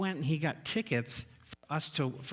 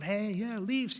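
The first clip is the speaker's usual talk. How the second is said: cheering fans; 7 semitones higher, slower than usual. Retold or imitated. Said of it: "they're going".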